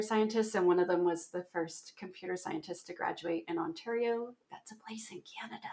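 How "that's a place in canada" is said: whispered, confiding aside; very soft whispered explanation; hushed aside. Real, not acted. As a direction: drop to a near-whisper, like a footnote. Joking aside to listeners.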